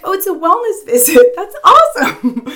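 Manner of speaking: high, loud, laughing enthusiasm; hammed-up cheery reaction; mock delight. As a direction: gush with playful excitement, a laugh inside. Playfully acting delight at new name.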